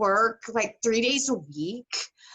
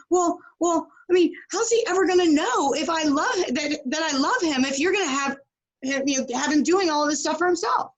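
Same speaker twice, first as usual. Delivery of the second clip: higher mock mother, pleading; clingy mom; 6 semitones higher than usual. Mother defending doing everything for son, lightly mocked. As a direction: whine like a mom afraid to let go. Mom justifying herself, quoted.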